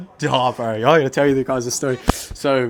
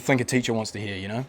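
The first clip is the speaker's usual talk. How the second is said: ordinary talk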